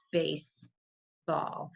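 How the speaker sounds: slow, split into two beats; clapping out syllables. Shown as a demonstration. Teacher slowly sounding out syllables for a child. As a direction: tap out each syllable slowly. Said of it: "how many syllables in baseball".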